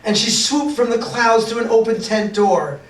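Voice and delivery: usual speaking voice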